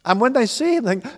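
ordinary delivery

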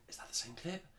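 high, disbelieving retold wondering; puzzled self-questioning. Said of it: "I was watching it going".

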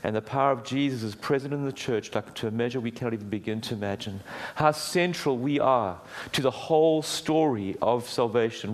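normal talking voice

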